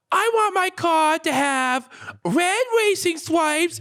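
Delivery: put-on very high, slow small-child voice; little kid voice. Kid telling his dad his car design, little kid voice. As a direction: draw out a squeaky kid's wish. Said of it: "I'm like".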